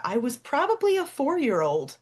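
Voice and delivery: usual speaking voice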